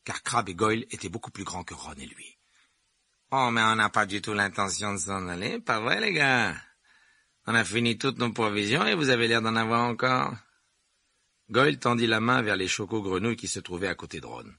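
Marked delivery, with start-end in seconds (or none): 3.3-10.3 loud, smug schoolboy; lightly voiced bully playing to his gang; mocking Malfoy. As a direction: taunt them with raised volume.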